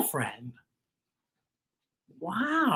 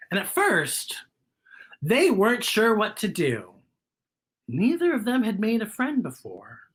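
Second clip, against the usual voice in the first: usual speaking voice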